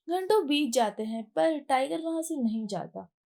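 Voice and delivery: ordinary delivery, nothing marked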